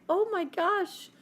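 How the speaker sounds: overwhelmed mom, drawn out; dismayed. Retold or imitated.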